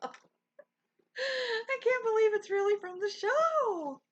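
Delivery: high, slow words through giggles; giggling in disbelief, drawn out; laughing delight. Real, not acted. Thrilled streamer laughing to her chat. Thrilled over a fan's gift.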